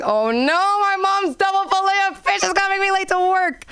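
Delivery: high whiny complaint; mock whiny self. Comedian annoyed in line at McDonald's, high mock whine. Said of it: "And I was like".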